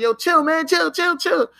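high, pleading mock voice repeating chill; imitating Tiger begging. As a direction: squeak out chill like a panicked man. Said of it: "Tiger was like".

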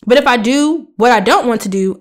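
ordinary talk, nothing marked

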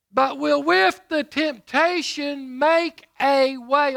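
loud and slow, stretching each word; slow booming sermon read; slow preaching. Acted. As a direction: draw the verse out from the pulpit. Preached scripture reading.

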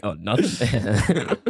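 slow, drawn-out fake innocence; innocent act. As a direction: play dumb, laughing. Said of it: "we'd be like".

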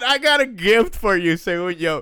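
nervous laugh in the voice; laughing nervously. Real, not acted. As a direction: say it through nervous giggles. Nervous gift announcement, laughing.

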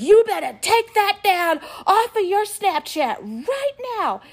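high, loud, drawn-out mock order; angry scolding. Older kid ordering girl to delete her Snapchat, angry scolding. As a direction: imitate an older kid laying down the law. Big brother scolds across the table.

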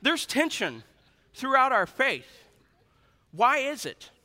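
emphatic preaching. Real, not acted.

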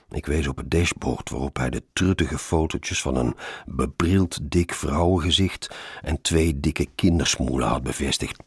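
ordinary delivery

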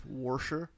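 "warsher" with an R in the first syllable; hard R wedged into "warsher"; dad's added R. Retold or imitated. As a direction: say washer as "warsher". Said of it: "my dad says".

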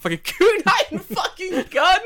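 high, slow, laughing. Real, not acted. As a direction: crack up saying it. Laughing repeat of phrase.

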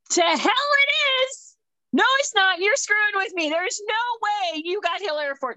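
high, loud, indignant protest; outraged disbelief. Retold or imitated. Wife protesting the husband's new duty station, loud and high. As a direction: refuse to believe it, loudly.

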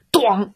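high, sudden bang sound; imitated crash. A narrator's reading. Door knocks out the chasing wolf, vocal crash. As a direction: imitate a door slamming into someone.

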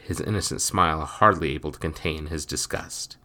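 usual speaking voice

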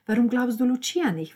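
high, insistent reasoning; exasperated appeal. Acted. Nanina urges Roberta to believe him, exasperated. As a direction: argue forcefully, pressing for an answer.

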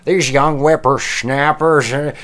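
put-on high, slow cranky old-timer voice; old prospector. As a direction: wail like a grumpy old geezer. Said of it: "they start talking shit about the young generation".

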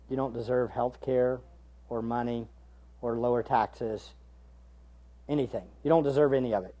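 soft, low, sober rebuke; quiet rebuke. Real, not acted. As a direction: bring it down to a grave hush.

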